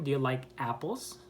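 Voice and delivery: "apples" lifting at the end; rising question intonation. Shown as a demonstration. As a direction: raise "apples" as a yes-or-no question. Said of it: "goes up".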